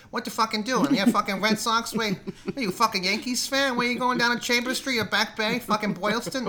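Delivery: high-pitched, sweary Boston local; hammed-up Boston accent. Shown as a demonstration. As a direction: rant street names like a cranky Bostonian. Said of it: "I can only lock into it".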